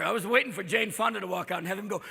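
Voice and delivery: ordinary delivery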